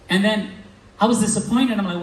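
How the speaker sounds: ordinary talk, nothing marked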